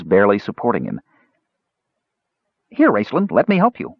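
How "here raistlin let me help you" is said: high, eager offer; eager kender. Acted. Kender trots after the mage, high eager offer. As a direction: pipe up eagerly. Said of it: "offered Earwig".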